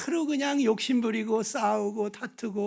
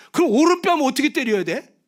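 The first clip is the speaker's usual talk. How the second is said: usual speaking voice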